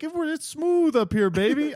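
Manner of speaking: high, crooning smooth-talker voice; smooth-talking Davidson. Retold or imitated. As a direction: ham up a cool pilot crooning baby. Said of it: "starts calling everybody baby".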